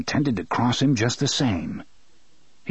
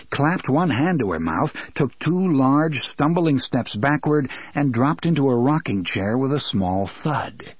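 plain reading voice

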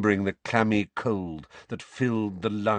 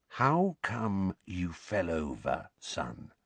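slow, soft bedside voice; unnaturally quiet; 6 dB quieter, slower than usual. A narrator's reading. Uncle gently questioning his shaken son, unnaturally quiet. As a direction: speak as if to a very ill person. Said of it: "in an unnaturally quiet voice".

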